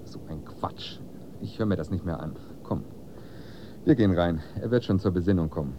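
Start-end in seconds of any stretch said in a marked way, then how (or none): 0.0-1.0 whisper. Acted. Father mutters in the argument.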